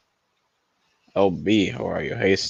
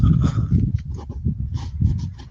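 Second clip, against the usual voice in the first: shocked gasp. Real, not acted. Shocked by the analogy.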